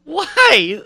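very high-pitched, baffled questioning; comically bewildered, high falsetto-like questions; incredulous. Pretend. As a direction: squeak out your disbelief. Incredulous reaction to fighter's answer.

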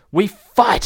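high, loud heroic declaration; rally him to fight; punchy warrior call; battle resolve. Acted. Wilma rallies Tristan to battle.

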